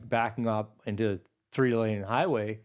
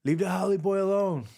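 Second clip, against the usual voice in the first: high-pitched calling out, retold; mock holler, drawn high; imitated yell; 9 semitones higher than usual. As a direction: imitate a neighbor yelling to warn them off. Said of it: "he yelled out".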